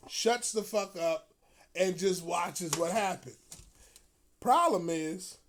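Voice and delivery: ordinary delivery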